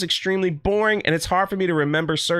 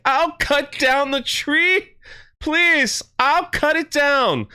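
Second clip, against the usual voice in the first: high, slow, desperate begging; pleading elf; 8 semitones higher, slower than usual. Retold or imitated. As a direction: plead to save the others, pitched way up. Elf begs orcs to spare others.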